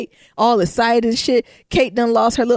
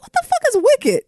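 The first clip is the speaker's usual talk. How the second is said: incredulous outburst; 4 dB louder than usual. Retold or imitated. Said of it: "I'm like".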